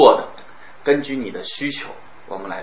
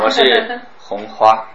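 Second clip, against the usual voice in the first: slow 我是红花, heavy and grave; mock-solemn slow 我是红花; slow weighty tone; 4 dB louder, much slower than usual. Shown as a demonstration. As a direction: make it sound weighty and important. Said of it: "我就给人一种很压抑的感觉".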